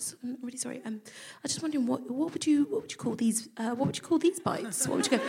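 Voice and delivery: nervously asking the women about bites; timid quiet question. Retold or imitated. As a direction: ask nervously, almost under breath.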